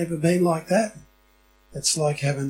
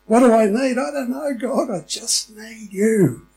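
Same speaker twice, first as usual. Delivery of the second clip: pleading prayer; 6 semitones higher, 5 dB louder than usual. Retold or imitated. Voicing a helpless prayer.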